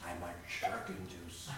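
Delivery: very low, soft, laughing; deep silly voice. Acted. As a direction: drop deep and giggle. Bird says made-up word.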